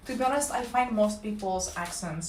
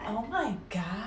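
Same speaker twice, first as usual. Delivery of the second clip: put-on Valley girl vowels; 3 dB quieter than usual. Shown as a demonstration. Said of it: "lengthen your vowels".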